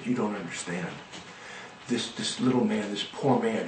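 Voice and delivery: a hint of weeping in a hushed voice; tearful rebbe. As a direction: speak through quiet tears. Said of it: "with tears started to come down the Rebbe's face".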